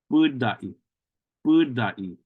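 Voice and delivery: budai with the first U held long; budai, long stressed U, falling end; lengthened first U. Shown as a demonstration. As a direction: stretch the U in budai, then a short dai. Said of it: "That U sound is kinda stressed".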